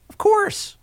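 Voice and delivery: very high, squeaky falsetto; helium voice. Pretend. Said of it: "That's my helium voice".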